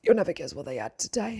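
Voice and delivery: low, hushed imitation of an upset boy; imitating tearful child. Upset son telling mum after school, hushed and tearful. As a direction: retell it as a teary-eyed child, half whispered. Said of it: "watery eyes, and he said".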